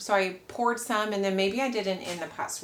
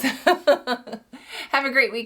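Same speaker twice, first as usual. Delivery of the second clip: laughing goodbye; 4 semitones higher, 3 dB louder, much slower than usual. Real, not acted.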